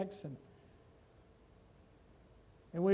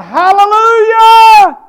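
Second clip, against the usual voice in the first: slow, far higher, full-throated cry; archangel's shout; 12 semitones higher, 21 dB louder, slower than usual. Acted. Archangel heralding the Lord's return, huge slow shout. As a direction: blast it out like an archangel. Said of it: "the voice of the archangel will shout".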